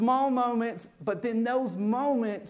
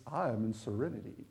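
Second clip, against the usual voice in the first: dreamy ad voice; 9 semitones lower, 10 dB quieter than usual. Pretend. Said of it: "It says".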